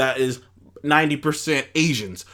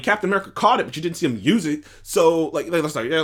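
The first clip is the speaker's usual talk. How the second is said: ordinary talk, nothing marked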